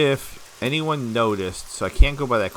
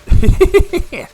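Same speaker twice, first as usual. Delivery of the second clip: sudden high shrieking laugh; a loud, high laugh bursting out; burst of laughter; 12 dB louder than usual. Real, not acted. Big laugh after jump.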